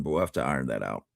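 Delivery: usual speaking voice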